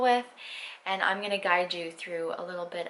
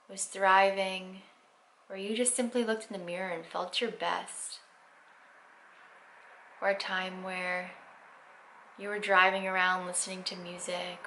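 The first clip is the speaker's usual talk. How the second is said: guided meditation; 6 dB quieter than usual. Acted. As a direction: ease them into stillness, unhurried.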